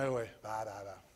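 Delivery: very low, trailing-off "yeah, right"; knowing low murmur, fading away; dismissive mumble. Acted. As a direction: play an unimpressed onlooker, barely audible. God's amused look at him, voiced.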